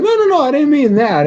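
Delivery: high, hasty mock backpedal; flustered denial. Bolin denying they are dating, mock flustered denial. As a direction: imitate someone embarrassed, starting high.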